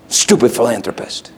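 usual speaking voice

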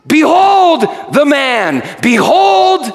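proclaiming shout. Acted. Pilate presenting Jesus to crowd.

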